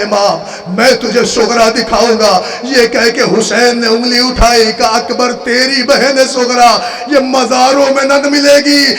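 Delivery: chanted, high-pitched mourning oration; lamenting zakir reciting to mourners; majlis recital. Acted. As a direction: preach the tragedy at full force, wailing.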